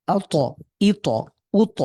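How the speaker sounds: আ ত, ই ত, উ ত with a doubled, punched t; each t sounds twice, like an echo, in আ ত, ই ত, উ ত; echoing bounce. Shown as a demonstration. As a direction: press the t and release it with a bounce in আ ত, ই ত, উ ত. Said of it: "দুইবারের মতো করে উচ্চারণ".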